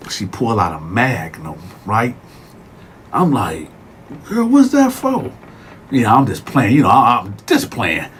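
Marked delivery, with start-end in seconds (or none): 4.3-5.3 high, startled question; surprised when the guard pulls out a condom. Retold or imitated. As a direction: jump up, caught off guard.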